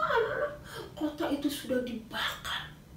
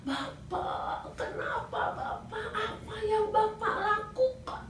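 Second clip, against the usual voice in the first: pleading question; 12 semitones higher, slower than usual. Acted. Joshua questions Achan after defeat.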